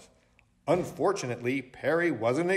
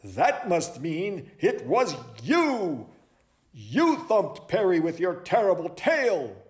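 high, emphatic detective voice; dramatic accusation; 5 semitones higher than usual. Acted. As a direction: lay the blame with drama. Owl accuses a suspect.

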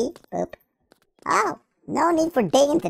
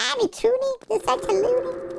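silly high voice; 12 semitones higher, 4 dB quieter than usual. Pretend. Silly high voice while playing.

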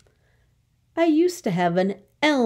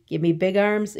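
normal talking voice

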